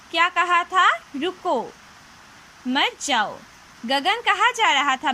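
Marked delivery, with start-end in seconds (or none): 1.0-3.4 रुको by itself, then मत जाओ after a pause. Shown as a demonstration. Teacher quotes Mansi's first sentence, pausing after रुको. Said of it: "तो बच्चों, पहले वाक्य में".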